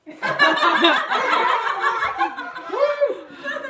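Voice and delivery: high, loud cackling laugh in character; big hammed-up laugh. Cook Earl laughs at a cheeky customer, high loud cackle.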